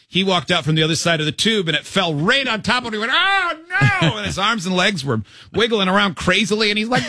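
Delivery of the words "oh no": high, drawn-out cry of alarm; hammed-up high-pitched panic; mock panicked cry. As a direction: wail it like a cartoon victim. Acts out friend as tire falls.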